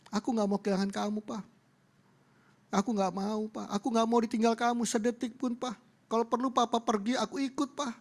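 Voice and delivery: high, pleading mock-crying voice; imitating tearful child. Daughter begging her father not to leave, mock tearful. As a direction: plead like a daughter close to tears. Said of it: "Nangis anaknya".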